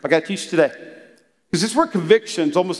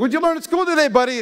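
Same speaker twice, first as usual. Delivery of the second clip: cheerful dad to son; 8 semitones higher, faster than usual. Retold or imitated. Dad asking young son.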